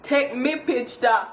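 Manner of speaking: "Take me picture" slow in Jamaican Patois, "me" not "my". Shown as a demonstration. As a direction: say "Take me picture" the yardie way, slowly. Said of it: "take my picture is take me picture".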